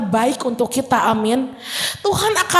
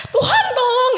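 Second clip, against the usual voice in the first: high laughing mock cry for help; drowning Peter; 13 semitones higher than usual. Preacher mocks Peter calling out while sinking. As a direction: squeal it out like a sinking man. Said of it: "mungkin ada jedanya gini loh".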